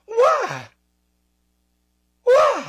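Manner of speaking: high, pleading, squealed; shrill-high exasperated plea; exasperated why. Real, not acted. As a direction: cry out why in disbelief.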